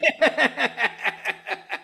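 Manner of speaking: long high burst of laughter; laughing. Real, not acted. Co-host admitting he still looks at reviews, laughing.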